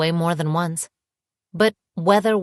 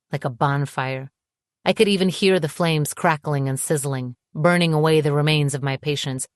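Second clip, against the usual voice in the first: usual speaking voice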